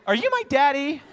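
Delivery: put-on high, loud little-kid question; imitated little daughter. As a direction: pipe up like a small girl in public. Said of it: "she's like".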